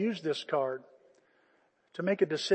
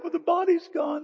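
grieving Mary; 10 semitones higher than usual. Acted. Mary answering the angels.

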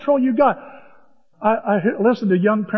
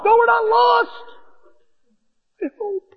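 panicky squeak; 13 semitones higher, slower than usual. Retold or imitated. Panicked denial.

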